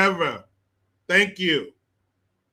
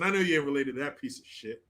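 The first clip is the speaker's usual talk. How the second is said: ordinary talk, nothing marked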